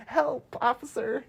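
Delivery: high, soft mock cry for help; fake helpless plea. Girlfriend appealing to officer, high feigned helplessness.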